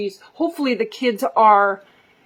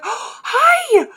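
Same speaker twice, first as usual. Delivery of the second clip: surprised recognition; 13 semitones higher, 3 dB louder than usual. Retold or imitated. Recognizing the mom unmasked.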